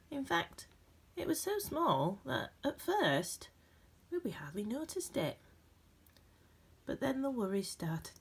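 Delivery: gentle, expressive storybook reading with dramatic pauses; reading to children. A narrator's reading. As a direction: read it like a bedtime picture book for a class. Reads picture book aloud.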